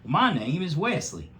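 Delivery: a high little bunny introducing himself; Wesley the bunny. A narrator's reading. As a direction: lift it up into a sweet boy bunny. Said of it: "said Wesley".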